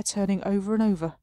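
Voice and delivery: ordinary talk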